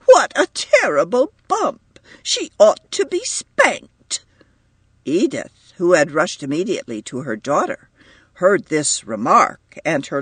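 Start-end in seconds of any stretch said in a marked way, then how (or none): 0.0-4.2 high, louder outraged mother; indignant. A narrator's reading. Mother about the girl, high indignant outburst. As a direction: spit out the blame, slowing at the end.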